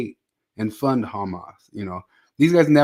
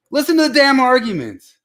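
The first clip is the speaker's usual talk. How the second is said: loud, high exasperated shout; exasperated outburst; 13 semitones higher, 7 dB louder than usual. Real, not acted. Fed up with hosts who didn't listen, exasperated shout. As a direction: let the frustration burst out.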